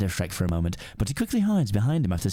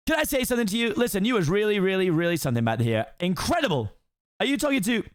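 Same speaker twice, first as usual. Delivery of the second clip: high fast-talking donkey voice; excited Donkey; 9 semitones higher than usual. Acted. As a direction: gush admiringly at the ogre. Second take, correct line.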